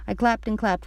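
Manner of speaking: plain reading voice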